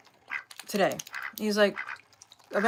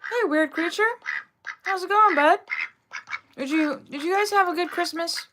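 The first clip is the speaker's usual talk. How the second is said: pet voice; 8 semitones higher, 4 dB louder than usual. Real, not acted. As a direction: coo at the little creature. Greeting a pet.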